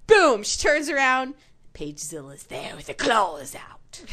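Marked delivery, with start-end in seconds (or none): 1.7-4.0 whisper. Retold or imitated. Monster-movie narration of Paige.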